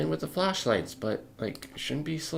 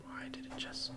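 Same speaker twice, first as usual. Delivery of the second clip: puzzled whisper; 16 dB quieter than usual. Real, not acted. Muttering at a broken program.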